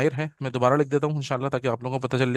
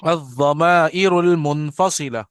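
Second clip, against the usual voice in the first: slow and louder for the class to repeat; slow model reading; 5 dB louder, slower than usual. Shown as a demonstration. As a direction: model the term slowly before they echo. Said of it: "पढ़ें".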